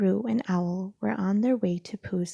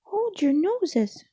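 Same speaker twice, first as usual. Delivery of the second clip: high, slow warning cry; cried out; 11 semitones higher, 5 dB louder, slower than usual. Acted. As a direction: cry it out to everyone. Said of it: "cried Piglet".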